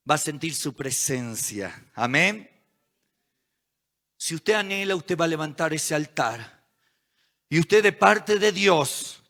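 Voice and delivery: soft, low, spaced-out sermon delivery; solemn preaching. Real, not acted. Preacher promising church they will feel God's presence, solemn and hushed. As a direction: let each promise settle with long pauses.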